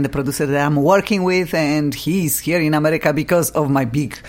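ordinary talk

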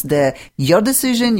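normal talking voice

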